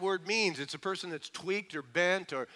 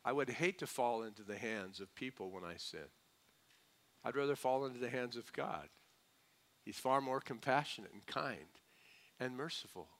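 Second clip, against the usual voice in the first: hushed sincere aside; 5 semitones lower, 11 dB quieter than usual. Real, not acted. Preacher drops to intimate tone.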